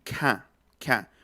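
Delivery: "K, K" said with a breathy release; aspirated k. Shown as a demonstration. Teacher isolates the aspirated k sound. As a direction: let air follow each k. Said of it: "se va a pronunciar así".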